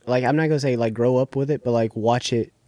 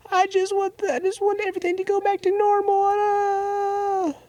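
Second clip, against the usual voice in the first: mock crying. Crying girl pleading at the buried bust, high mock wail. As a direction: imitate a girl bawling, way up high. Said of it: "She's crying".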